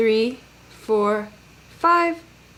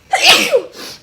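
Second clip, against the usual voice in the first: loud sneeze burst; a sudden real sneeze; 6 dB louder than usual. Real, not acted. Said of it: "if you're sneezing, that's normal".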